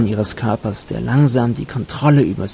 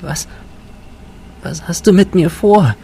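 whispered in fear; 8 semitones higher than usual. A narrator's reading. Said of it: "flüsterte sie".

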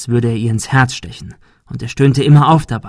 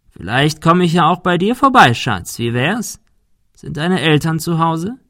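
sing-song rise into mock-friendly questions; taunting; 6 semitones higher than usual. A narrator's reading. Bully suggests visiting the girl at home, taunting. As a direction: toy with them, pitch swooping up.

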